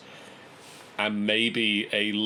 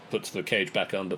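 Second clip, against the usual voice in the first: normal talking voice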